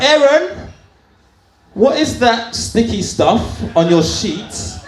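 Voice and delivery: high, slow suspicious mother's question; suspicious mum. Retold or imitated. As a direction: draw out the question like a mum who knows.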